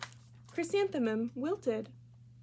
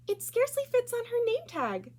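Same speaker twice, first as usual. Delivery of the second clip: put-on much higher girl's voice, teasing; mocking schoolgirl; 13 semitones higher, 3 dB louder than usual. Rita teasing Chrysanthemum about her name tag, high mocking voice. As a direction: point and poke fun in a high child voice.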